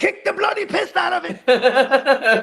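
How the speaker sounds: mock British. Mock British exclamation, laughing.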